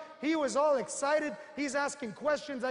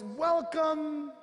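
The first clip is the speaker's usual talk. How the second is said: drawn-out, mock-happy greeting; happy welcome; 3 semitones higher than usual. Joker opens his door to the visiting recruiter, hammed-up happy welcome. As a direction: greet the guest with showy delight. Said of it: "The guy answers all happy".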